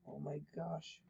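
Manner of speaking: whispered, barely there; breathless whisper. Real, not acted. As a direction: breathe it out in dread. Tense before jump scare.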